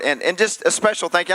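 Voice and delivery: usual speaking voice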